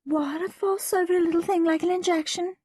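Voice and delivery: high-pitched doctor chiding a fuss; dismissive doctor. A narrator's reading.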